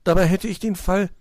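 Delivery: put-on high, pouting child's disappointment; sulking. A narrator's reading. Young detective wanting the case alone, sulking. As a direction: grumble loudly like a child cheated of glory. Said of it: "schmollte Borstel".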